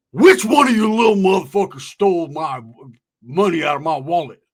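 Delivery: loud furious demand; furious old rancher shouting at kids; angry shout. Retold or imitated. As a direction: bellow it like an angry cowboy. Angry old country man storms in.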